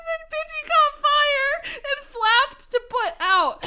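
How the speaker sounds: high, slow, laugh-choked reading; cracking up. Real, not acted. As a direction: push the story line through real laughter. Rereads the line through laughter.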